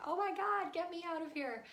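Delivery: high mock-desperate plea; exasperated. Parent endlessly listening to child wants out, mock desperate. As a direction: act out an overwhelmed parent's inner cry.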